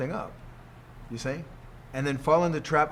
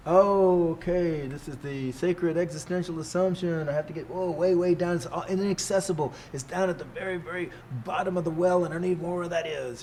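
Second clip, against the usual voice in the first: mock awed seeker; 5 semitones higher than usual. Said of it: "You hear it like".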